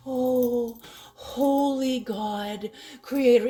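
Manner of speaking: high, loud, stretched-out reverence; mock piety. God quoting a falsely pious worshipper, mock reverence. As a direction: draw it out in exaggerated devotion. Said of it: "come at me like".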